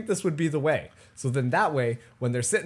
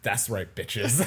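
lower, softer smug taunt; smug gloating; 9 semitones lower, 6 dB quieter, slower than usual. Acted. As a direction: savour the revenge on the old team. Kenneth gloating at old team.

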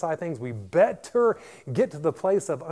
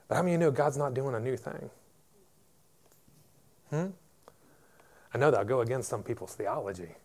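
ordinary delivery

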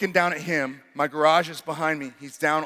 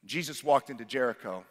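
normal talking voice

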